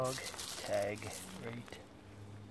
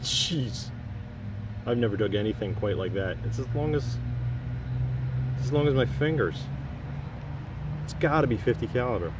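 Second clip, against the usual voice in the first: breathless treasure hunter; 3 semitones higher, 6 dB louder than usual. Real, not acted. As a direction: gasp it out like a big find. Amazed at huge shell casing.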